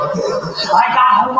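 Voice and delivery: ordinary talk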